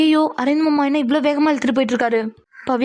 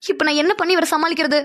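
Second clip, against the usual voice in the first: ordinary delivery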